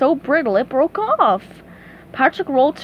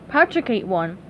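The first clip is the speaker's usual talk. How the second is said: plain reading voice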